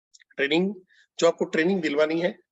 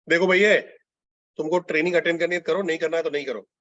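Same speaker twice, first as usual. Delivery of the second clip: brusque, take-it-or-leave-it instruction; curt and dismissive; rude. Shown as a demonstration. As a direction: rudely lay down the ultimatum, hard on the opening. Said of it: "rude".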